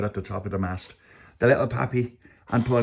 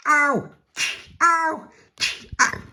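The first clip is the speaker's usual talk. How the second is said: high, short "ow" yelps; acted pain yelps; much slower than usual. A narrator's reading. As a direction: yelp at each slap. Puppy hit by tentacle.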